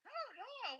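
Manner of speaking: high, giddy cry to the Lord; excited panic. Retold or imitated. Retelling frantic gifting in a high, giddy voice. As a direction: pitch it up like a fan losing control.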